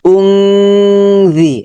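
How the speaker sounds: উংজি with long nasal ng held; ghunnah demo. Shown as a demonstration. Teacher showing ghunnah to students, drawn out. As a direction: hum the ng of উংজি slowly. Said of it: "এখানে গুণনা হয়েছে".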